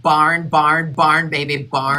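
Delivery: put-on loud, drawn-out country-accent "barn". Fan insisting on the lyric from Picture to Burn, country accent. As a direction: belt it out in a country twang. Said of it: "the country accent again".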